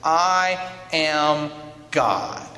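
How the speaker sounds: very slow, spaced-out words; explicit declaration. Retold or imitated. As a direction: spell it out word by word. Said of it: "explicitly says in the Gospels".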